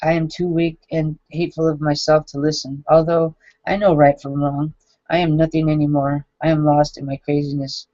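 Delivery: ordinary talk, nothing marked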